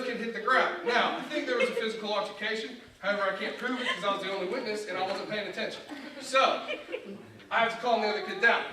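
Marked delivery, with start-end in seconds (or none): none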